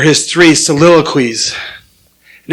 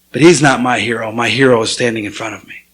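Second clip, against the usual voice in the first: low, softened heartfelt admission; hushed, earnest quote sinking lower; quietly sincere; 7 semitones lower, 4 dB quieter than usual. Retold or imitated. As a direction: retell a son's tender confession, dropping the voice. Son's tender confession to father.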